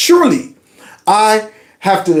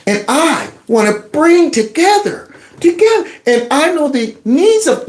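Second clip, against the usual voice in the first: mock Kamala Harris; 9 semitones higher than usual. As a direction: lilt it like a preachy politician. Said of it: "crap Kamala Harris was saying".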